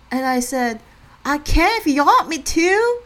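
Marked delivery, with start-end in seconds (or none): 1.2-3.0 high, loud retold reply; exaggerated high-pitched playful comeback. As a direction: answer back loudly in a raised pitch. Retells own comeback to cashier.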